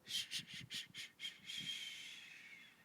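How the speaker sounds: whispered shushing, fading away; hushing. Pretend. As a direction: shush them like a sleepy child. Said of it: "winter solstice say".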